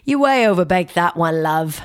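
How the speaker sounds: mock British judge's put-down; terrible British accent. Host quotes a talent judge to a contestant, bad British accent. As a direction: do a badly faked British accent. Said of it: "I know that's a terrible accent".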